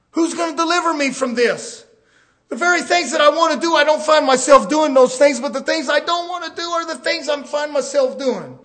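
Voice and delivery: loud, high desperate plea; anguished cry. Retold or imitated. Paul crying out in his struggle, loud and high. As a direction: cry out for rescue. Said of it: "That's what he said".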